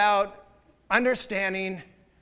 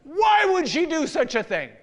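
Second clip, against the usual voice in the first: high, louder bewildered question; upset boss; 7 semitones higher, 5 dB louder than usual. Retold or imitated. Embarrassed director asks the pastor why, high and exasperated. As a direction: play his exasperation. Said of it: "He's all upset".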